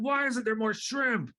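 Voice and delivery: high, slow, petulant whine; whiny complaint. Retold or imitated. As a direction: drag it out like a sulking customer. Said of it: "they're like, going".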